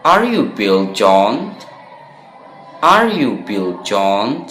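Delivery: Are you Bill John said slowly, rising at the end like a real question; slow teacher-modelled question with rising intonation; questioning tune. Shown as a demonstration. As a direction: ask it slowly with a clear upward lilt. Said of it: "seperti bertanya".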